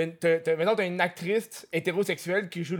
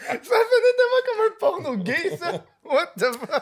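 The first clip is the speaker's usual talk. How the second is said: laughing hard, high and loud; cracking up through the words; 12 semitones higher, 5 dB louder than usual. Real, not acted. Laughing at the sound of it.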